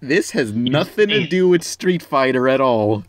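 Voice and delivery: normal talking voice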